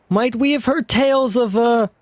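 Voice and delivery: lightly voiced hero; voiced game character. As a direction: read the hero's lines.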